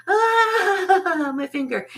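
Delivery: a touch of a high frightened cry; mock fright. Retelling the finger trick to viewers, light high fright. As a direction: briefly relive the scare, high and quick.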